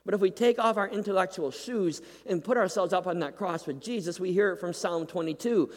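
ordinary talk, nothing marked

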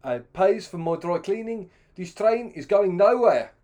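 loud, high Rhodesian ultimatum; Rhodesian accent. Retold or imitated. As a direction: lay down the law as an angry Rhodesian colonial. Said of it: "and he said".

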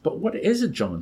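high-pitched frightened question, lightly hushed; aghast. A narrator's reading. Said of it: "she whispered".

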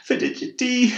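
high, loud tearful cry. A narrator's reading. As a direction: sob the question at him. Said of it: "she grut".

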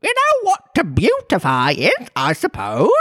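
high, loud Griffin voice; exclaiming Griffin. Acted. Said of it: "it exclaimed".

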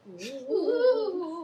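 high laughing ghostly woo; ghostly wail. Pretend. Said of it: "So I'm gonna make a ghost sound".

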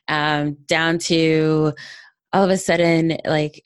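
normal talking voice

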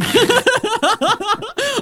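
shrieking laugh, very high; high squealing laugh. Real, not acted. Laughing at the drunk story.

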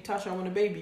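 ordinary delivery

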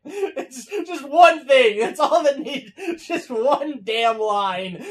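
loud, high lament through laughter; real laughing exasperation. Real, not acted. Viewer admitting one line broke him, real laughing exasperation. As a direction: protest helplessly while cracking up.

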